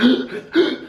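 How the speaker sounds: high wheezy gasp for air; demonstrated breathless gasp; struggling gasp. Shown as a demonstration. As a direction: imitate gasping after hard riding. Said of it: "It's really struggling there".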